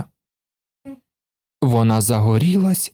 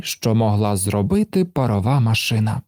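plain reading voice

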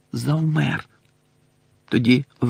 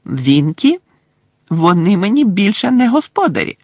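defiant, louder elf reply; 7 semitones higher, 5 dB louder than usual. Acted. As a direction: throw it back defiantly. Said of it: "виклично сказав Добі". (виклично)

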